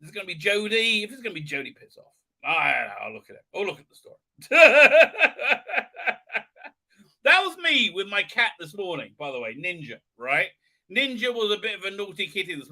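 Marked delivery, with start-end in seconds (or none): 4.4-6.4 high, loud burst of laughter; long rapid cackle; laughing. Real, not acted. Streamer cracking up at a story, loud high laugh.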